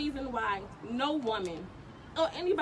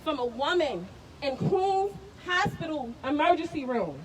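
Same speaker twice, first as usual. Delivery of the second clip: ordinary delivery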